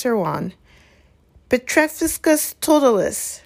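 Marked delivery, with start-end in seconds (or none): 1.5-3.4 high, ringing spell cry; cried out. A narrator's reading. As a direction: cast the spell with a high call. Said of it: "she cried".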